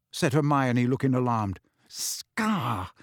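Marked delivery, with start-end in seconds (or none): 1.9-2.9 mumbled. A narrator's reading. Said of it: "Harry mumbled".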